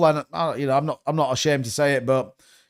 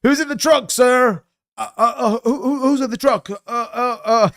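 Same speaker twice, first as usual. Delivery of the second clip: much higher, stuttering put-on voice; mock stammering questioner; 8 semitones higher than usual. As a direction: stammer it out high and flustered.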